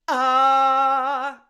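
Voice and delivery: glottal attack on Ah, loud and sudden; hard onset. Shown as a demonstration. Teacher demonstrating a hard onset on Ah. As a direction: burst the Ah open from a held breath. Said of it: "So I'm gonna hold".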